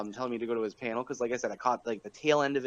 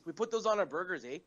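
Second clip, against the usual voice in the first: put-on joking Canadian accent with a raised pitch; mock Canadian; 8 semitones higher, 4 dB louder, faster than usual. Joking back about syrup on burgers, mock Canadian. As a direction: play up the Canadian "eh".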